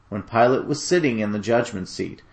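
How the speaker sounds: ordinary delivery, nothing marked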